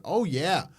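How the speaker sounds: playing the eager American tourist chasing roots; mock American accent. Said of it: "an American walking around going".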